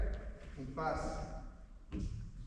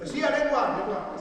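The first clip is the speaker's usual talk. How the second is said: loud, higher shout then settling; calling out; 6 semitones higher, 8 dB louder than usual. Real, not acted. Coach sending players to look, loud call. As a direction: send them off with a shout.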